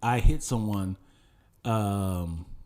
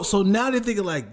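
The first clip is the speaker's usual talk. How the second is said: ordinary talk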